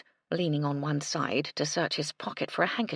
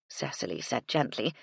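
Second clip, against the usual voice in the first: usual speaking voice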